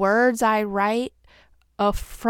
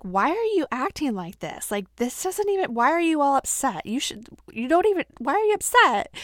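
baffled, rising higher in pitch; confused husband; 5 semitones higher, faster than usual. Retold or imitated. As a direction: imitate an exasperated, uncomprehending husband. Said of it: "he was always so confused".

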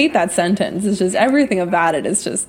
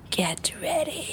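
spooky whispered warning; breathy ghost-story hush; scary voice; 7 semitones higher, 12 dB quieter, slower than usual. Acted. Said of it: "my best imitation at, like, a scary voice".

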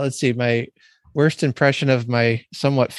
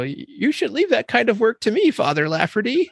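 a touch higher, mild Irish-tinged housekeeper; lightly voiced Missus Wilson; 9 semitones higher than usual. Housekeeper scolding the priest, lightly voiced. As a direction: gently mimic the housekeeper's polite scolding. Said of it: "she says".

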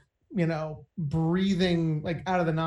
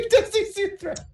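mock echo; 3 dB louder than usual. Mocking echo of friend's question.

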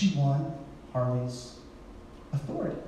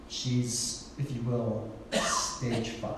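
softer, half-whispered "stage five"; conspiratorial near-whisper, quieter; hushed aside; 6 semitones lower, 7 dB quieter, much slower than usual. Acted. Punchline about dying.

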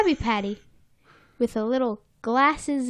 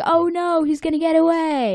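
mock alarm; 6 semitones higher than usual. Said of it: "then everyone's like".

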